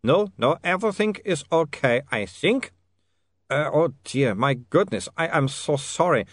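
put-on high, accented apology; apologetic vampire. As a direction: apologise as a recovering foreign vampire. Otto recovering, apologetic, accented.

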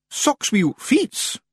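high, slow Scots Feagle voice; squeaky-high wee Scotsman, drawn out; puzzled Feagle leader. A narrator's reading. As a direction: drag it out high in a Scots accent.

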